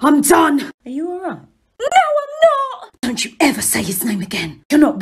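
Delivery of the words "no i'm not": high tearful. Acted. Harry breaking down.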